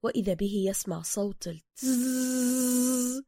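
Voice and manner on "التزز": long imitated taser buzz; electric shaver buzz. A narrator's reading. Narrator imitates the taser Bruce hears, long buzz. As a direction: stretch the z like an electric razor. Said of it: "المميز الشبيه بصوت ماكينة الحلاقة الكهربائية".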